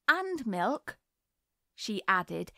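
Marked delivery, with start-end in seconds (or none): 0.1-1.0 higher, prim little hostess voice; imitating her mother. A narrator's reading. Little girl pouring tea mimics her mother, prim mock-genteel. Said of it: "imitating her mother".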